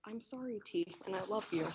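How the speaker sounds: hushed, meek and low; small, tender apology; soft apology. Real, not acted. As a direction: apologise very softly, almost under the breath. Meek apology on being asked again.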